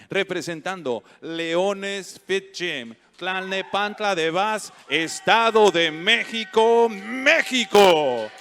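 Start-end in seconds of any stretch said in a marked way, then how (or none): 4.9-8.3 drawn-out booming name call, much higher; big fighter introduction. Real, not acted. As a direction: stretch the name out like a ring announcer. Announcer calls fighter's name.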